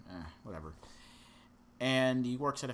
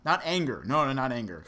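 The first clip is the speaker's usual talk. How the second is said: emphatic, much louder and higher no; loud denial; 4 semitones higher, 12 dB louder than usual. Pretend. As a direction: protest too much, loudly. Joking denial.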